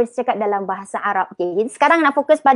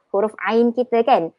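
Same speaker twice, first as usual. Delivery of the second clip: usual speaking voice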